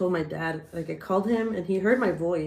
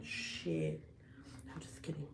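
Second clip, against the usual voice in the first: whisper; 3 semitones lower, 16 dB quieter than usual. Real, not acted. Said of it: "whispered aside after boast".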